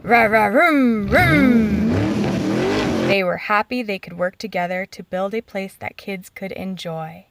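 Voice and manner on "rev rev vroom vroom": loud revving engine noise, rising at the end; loud engine vroom. A narrator's reading. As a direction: roar it like a toy digger engine. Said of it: "And Asher roared his engine".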